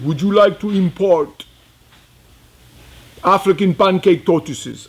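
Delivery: light put-on African accent for the dealer's question. The Tanzanian dealer offers tortoises on the phone, light African accent. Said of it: "very thick African accent".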